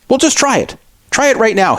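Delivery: lightly acted impatient urging; mildly pushy. Store employee pressing the customer to try, mildly pushy. Retold clerk pressing the caller.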